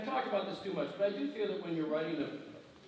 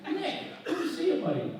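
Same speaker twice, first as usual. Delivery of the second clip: much higher, sing-song mock friendly greeting; mocking Joe's greeting; 12 semitones higher, 4 dB louder than usual. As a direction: parody a too-friendly rival saying hello.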